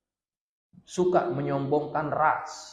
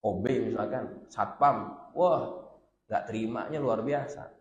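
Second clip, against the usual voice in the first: usual speaking voice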